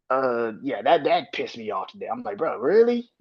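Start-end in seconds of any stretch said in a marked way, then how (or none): 2.4-3.1 higher-pitched retold disbelief; incredulous reaction. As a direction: toss it up high like you can't believe it.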